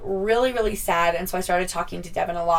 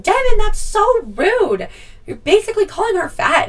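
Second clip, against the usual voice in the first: scolding mom; 8 dB louder than usual. Retold or imitated. Mother scolding son Devin for rudeness, shocked scolding. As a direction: chide him like a shocked mother.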